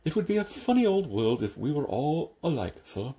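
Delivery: lisping servant's voice, eager to please; humble lisping Igor. A narrator's reading. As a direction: lisp every s like a devoted Igor. Igor's homely wisdom.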